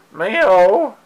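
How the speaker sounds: high playful cat meow; mock meow. Meowing at her cat.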